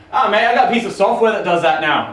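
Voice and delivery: a hint of a bad English accent; lightly put-on English accent; terrible English accent. Mark on the phone bragging, in a bad English accent. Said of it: "I do a terrible fucking English accent".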